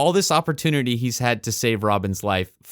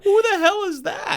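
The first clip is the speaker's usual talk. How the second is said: very high, startled squeal of a question; mock shock. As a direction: act flabbergasted, pitch shooting right up. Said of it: "a moment of shock there".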